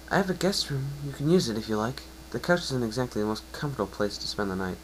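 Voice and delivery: a kind unicorn reassuring her patient; Twilight's voice. Acted.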